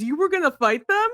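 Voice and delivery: high, mocking sing-song question; teasing put-on incredulous voice; SpongeBob-text mockery. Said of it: "I wrote in SpongeBob text".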